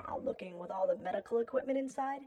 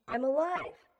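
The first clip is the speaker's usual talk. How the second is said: higher, louder rising exclamation; sudden jolt of realizing it, pitched up; startled realization; 6 semitones higher, 6 dB louder than usual. A narrator's reading. Waking from death, startled first thought.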